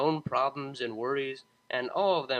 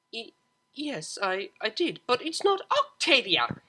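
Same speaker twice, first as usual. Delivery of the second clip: stuttering then high and loud protest; flustered stammer; 9 semitones higher, 6 dB louder than usual. Acted. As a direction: blurt it out in a panic. Daughter panics confessing.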